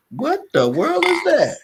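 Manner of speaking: high, loud startled question; surprised. Real, not acted. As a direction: react suddenly to something odd. Startled by something on screen.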